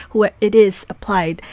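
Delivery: ordinary talk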